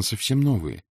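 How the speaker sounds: plain reading voice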